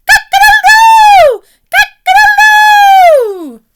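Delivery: very high, loud, slow crowing; a full-throated cock-a-doodle-doo; rooster crow. Acted. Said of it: "And the rooster crowed".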